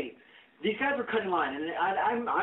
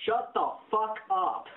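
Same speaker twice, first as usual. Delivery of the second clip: higher, slow, word-by-word put-down; retold firm rebuke; 5 semitones higher, slower than usual. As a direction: spell it out slowly to silence her. Retold snap back at complaining lady.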